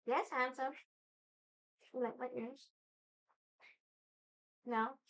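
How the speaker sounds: high, soft cooing to a pet; pet talk. Real, not acted. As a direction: coo sweetly at the cat. Talking to her cat.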